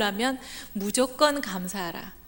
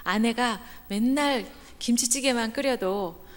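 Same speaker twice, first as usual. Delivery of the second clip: ordinary talk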